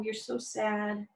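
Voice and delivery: drawn-out sorrowful tone; slow sad voice. Shown as a demonstration. Teacher showing children what forlorn sounds like, slow and sad. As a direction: let the words sink slowly, sadly. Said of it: "explaining forlorn, showing sadness".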